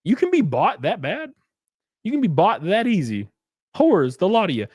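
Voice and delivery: a hint of a disgusted scold; scornful disbelief. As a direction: pitch up in mock outrage. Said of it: "And I'm like".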